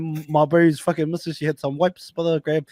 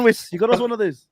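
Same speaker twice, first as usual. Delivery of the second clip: usual speaking voice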